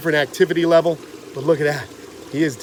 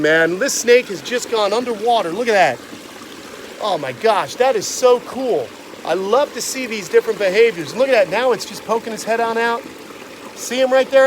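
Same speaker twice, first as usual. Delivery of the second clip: ordinary talk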